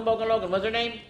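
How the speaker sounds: ordinary delivery, nothing marked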